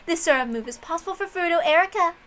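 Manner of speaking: high, bright-edged voice, pleased with her own move; smug detective. Acted. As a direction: lord it over the table with a smile in the voice. Plays a female detective figure.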